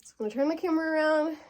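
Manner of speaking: normal talking voice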